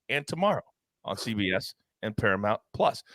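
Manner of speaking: normal talking voice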